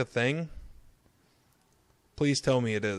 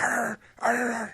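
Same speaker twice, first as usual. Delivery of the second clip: playful growl; mock growl; 11 semitones higher than usual. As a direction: growl like a dog. Imitating the kin girl's growl.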